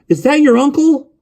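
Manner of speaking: put-on high, loud child's voice; piping, insistent boy's question. A narrator's reading. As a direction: blurt it out as a young boy. Boy asking urgently about the death.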